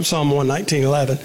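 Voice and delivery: usual speaking voice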